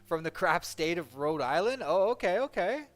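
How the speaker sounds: loud, high, amused disbelief; excited reaction. Real, not acted. Streamer loudly teasing a viewer's home state, excited.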